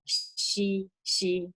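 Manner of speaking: "she" said like the start of sheep. Shown as a demonstration. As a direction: demonstrate "she" as the X sound. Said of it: "it pronounced like sheep".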